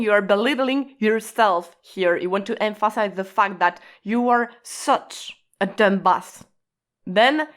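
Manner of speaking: ordinary talk, nothing marked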